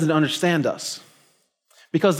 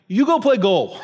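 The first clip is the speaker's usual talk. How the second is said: laughing mock order; 5 semitones higher than usual. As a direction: bark the brush-off through laughter. Mocking what players tell the weak guy.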